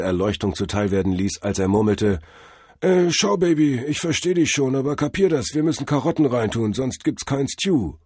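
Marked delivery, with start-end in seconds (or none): 2.8-7.9 high-pitched, hip guru voice; jive-talking drug-vision god. A narrator's reading. Hallucinated god explains carrots to the driver, high hip guru. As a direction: play a trippy god lecturing on carrots. Figure of Tod Hermes.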